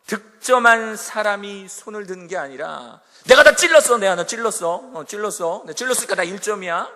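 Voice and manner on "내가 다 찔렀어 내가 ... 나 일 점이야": loud, bragging opener that settles into a quick boast; boastful claim. Retold or imitated. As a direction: brag about scoring the hit. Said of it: "이게 아니라".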